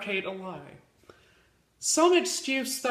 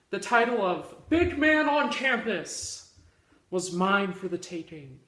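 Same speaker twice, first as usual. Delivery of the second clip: usual speaking voice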